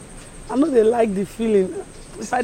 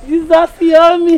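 exclaiming; 7 semitones higher, 9 dB louder than usual. Acted. She protests loudly.

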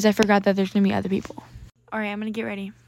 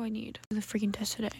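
ordinary talk, nothing marked

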